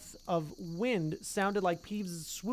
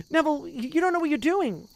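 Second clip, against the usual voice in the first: exasperated; 9 semitones higher, 4 dB louder than usual. Acted. Ron confronts Neville.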